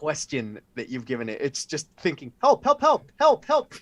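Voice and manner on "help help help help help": high, loud, frantic little creature cries; tiny panicked bee begging for help; pleading bee creature. Acted. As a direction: squeal for help like a panicked bug. Bee creature thinking again.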